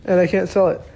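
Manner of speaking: retold disbelief, high-pitched; incredulous protest. Protesting at being told no.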